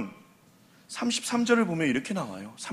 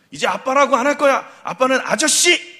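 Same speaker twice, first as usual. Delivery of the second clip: sulky daughter; 6 semitones higher, 6 dB louder than usual. Retold or imitated. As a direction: pout it out like an upset child. Daughter sulking at her father.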